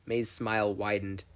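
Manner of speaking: plain reading voice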